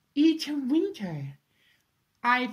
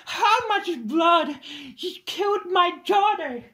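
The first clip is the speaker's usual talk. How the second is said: grieving rage; 7 semitones higher, 6 dB louder than usual. Acted. Mother mourning her daughter, enraged cry.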